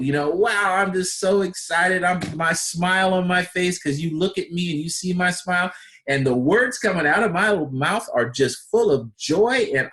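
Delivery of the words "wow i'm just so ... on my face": imitating a joyful person, higher and smiling. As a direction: gush with a big smile. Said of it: "Are they joyful?".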